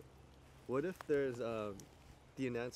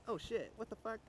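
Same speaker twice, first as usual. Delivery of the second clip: startled swearing; 9 semitones higher than usual. Pretend. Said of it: "and be like".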